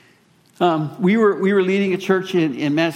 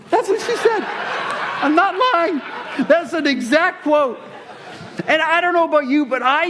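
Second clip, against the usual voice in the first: laughing; 11 semitones higher than usual. Real, not acted. Laughing at wife's quote.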